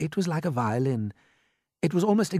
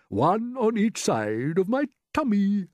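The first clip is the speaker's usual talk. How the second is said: higher, slow grasshopper voice; teasing old grasshopper; 8 semitones higher, slower than usual. A narrator's reading. Grasshopper revealing ears on his tummy, slowly teasing. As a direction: draw each word out with relish.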